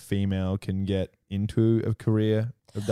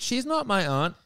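put-on bratty kid; 10 semitones higher, 3 dB quieter than usual. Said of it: "Bess is like".

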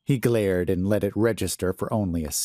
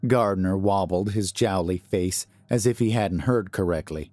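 ordinary delivery, nothing marked

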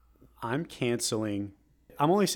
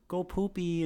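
put-on higher-pitched baby talk; mock pet voice; 6 semitones higher, slower than usual. Mocking people who talk to their pets, high puppy voice. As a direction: imitate an owner talking to a puppy. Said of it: "people that are like".

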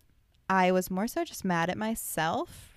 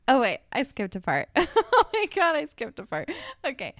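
flustered surprise; 7 semitones higher, 6 dB louder than usual. Real, not acted. Realizes she skipped a part.

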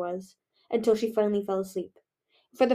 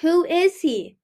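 loud, raised, menacing question; ominous demand; 7 semitones higher, 8 dB louder, much slower than usual. A narrator's reading. Winter demands to know who Moon is helping, ominously. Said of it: "his voice low and ominous".

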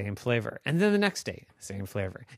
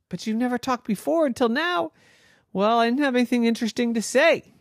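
put-on high, quick, excited little girl voice; girl Noah; 12 semitones higher, 5 dB louder, faster than usual. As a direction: squeak it out like an eager young girl.

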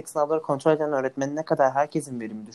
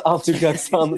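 mock retort; 2 semitones higher, 5 dB louder, slower than usual.

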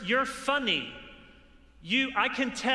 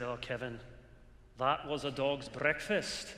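a light Scottish accent, imitating a famous preacher; Sinclair Ferguson's Scottish accent; 5 semitones lower, 4 dB quieter than usual. Preacher quoting Sinclair Ferguson's verdict on his sermon, light Scottish accent. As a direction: slip into a mild Scottish lilt for the quote. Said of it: "for this accent I'm about to do".

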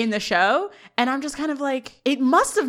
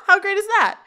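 excited cheer; 10 semitones higher, 6 dB louder than usual. Retold or imitated. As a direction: voice jumps up high and loud, thrilled. Turner cheering Corey's TV appearance.

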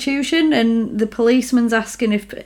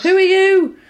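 higher, louder alarmed who are you; alarmed question; 9 semitones higher, 4 dB louder than usual. Retold or imitated. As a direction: call out to a stranger in fright. Donald faces hooded figure.

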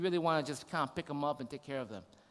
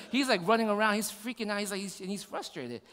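normal talking voice

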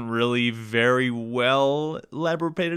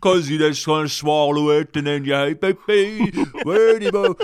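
bad Cockney impression; 3 semitones higher, 5 dB louder, slower than usual. Shown as a demonstration. Mocking the actor's famous accent, exaggerated bad Cockney. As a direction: swallow the words in a terrible Cockney accent. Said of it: "That's me doing a bad impression of his".

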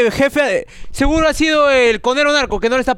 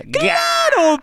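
very high, stretched squawk; exaggerated squeal. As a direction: squeal it out in mock enthusiasm. Reacción exagerada a la donación. (chillido agudísimo y exagerado)

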